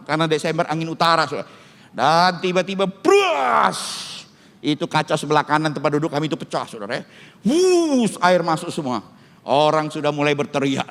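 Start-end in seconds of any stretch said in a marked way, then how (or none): 2.9-4.1 sudden high crash noise; explosive high-pitched crash imitation; imitated glass smash. Imitates the window shattering. 7.4-8.1 high sweeping whoosh; drawn-out, high-pitched whoosh noise; imitated water whoosh.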